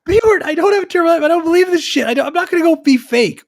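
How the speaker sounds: high-pitched, rapid-fire outburst of self-defence; worked-up, squawked denial; indignant protest. Real, not acted. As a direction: blurt it out fast and high, protesting hard. Indignant protest at being called a hater.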